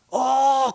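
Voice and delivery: high loud burst "어!"; mock outburst. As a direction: blurt it like something snapping inside. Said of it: "그러면서 막 쓰러졌을 것 같아요". (터질 듯한 외침 흉내)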